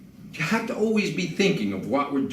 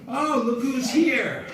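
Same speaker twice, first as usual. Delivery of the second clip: high, slow welcoming oh look who's here; drawn-out cheery greeting at the door; greeting a guest; 10 semitones higher, slower than usual. Pretend. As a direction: play the delighted host at the door. Acting answering door to guest.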